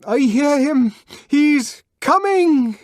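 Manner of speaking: high, loud, drawn-out cry; forced joining shout. A narrator's reading. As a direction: shout along with the frenzied crowd. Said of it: "jumped up and shouted".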